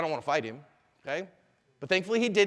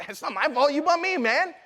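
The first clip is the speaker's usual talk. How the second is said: mock-indignant, higher and louder; confrontational; 8 semitones higher, 5 dB louder than usual. Bumped man squaring up at the bar, confrontational. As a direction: act out a bar hothead picking a fight.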